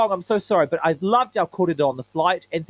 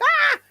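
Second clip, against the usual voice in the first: short, high mock shriek of fright; imitated fright scream; 5 dB louder than usual. Startled tea lady leaping off her seat, mock shriek. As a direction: yelp like someone who just got startled. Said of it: "jumped in the air like".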